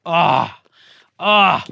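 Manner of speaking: a loud, pleased "ah, ah" at a favorite sound; delighted ah. Real, not acted. Maker hearing his favorite cassette sound, happy sighs. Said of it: "This is my favorite sound".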